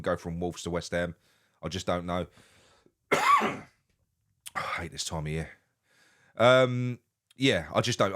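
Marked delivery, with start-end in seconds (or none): 2.7-5.5 coughing fit. Real, not acted. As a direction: speak through a coughing fit. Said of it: "sorry about the coughing".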